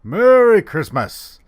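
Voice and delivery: booming, high-pitched ho-ho-ho greeting; loud, drawn-out festive Santa holler; Santa Claus. Acted. Santa greeting everyone for the holiday, booming and jolly. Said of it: "this is Santa here".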